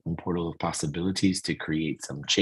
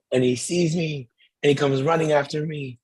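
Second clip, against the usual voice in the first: ordinary delivery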